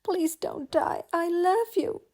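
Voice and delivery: high, loud and slow, tearful; sobbing plea. A narrator's reading. Belle pleading tearfully with the dying beast, loud. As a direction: beg him not to die. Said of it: "sobbing as she kissed".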